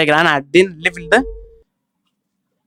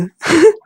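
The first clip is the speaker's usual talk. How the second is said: high laugh; 12 semitones higher than usual. Real, not acted. Surprised by moving obstacle.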